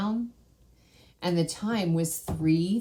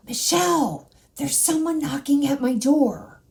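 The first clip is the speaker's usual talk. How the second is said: high, urgent mock whisper of her brother; loud urgent whisper; 7 semitones higher than usual. Brother waking her at night, urgent loud whisper. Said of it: "a loud, urgent whisper".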